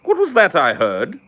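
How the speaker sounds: high-pitched mock British indignation; offended Brit. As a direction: play a scandalized, easily wounded Brit. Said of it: "you poke one little thing at them and they're like".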